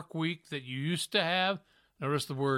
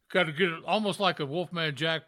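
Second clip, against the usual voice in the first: usual speaking voice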